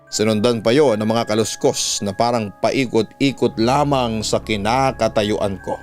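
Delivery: ordinary delivery, nothing marked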